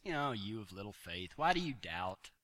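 low, soft, tender scolding; gentle rebuke. Retold or imitated. Jesus gently chiding Peter after pulling him up. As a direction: chide him softly like a father. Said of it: "grabs him and says".

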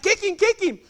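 high, loud urging; urgent command. Retold or imitated. Cowboy to rider on the next run, high urgent holler. Partner orders another run.

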